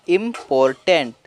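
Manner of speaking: drawn-out important; slow reading. Shown as a demonstration. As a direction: stretch important slowly, syllable by syllable. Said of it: "तोड़ तोड़ के पढ़ेंगे". (important को धीरे-धीरे खींचकर)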